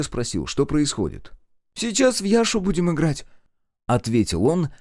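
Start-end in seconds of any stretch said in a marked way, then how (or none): 1.7-3.2 put-on high-pitched boy's answer; boy character. A narrator's reading. Boy tells narrator about the game, high-pitched. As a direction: pitch it up as a playing kid.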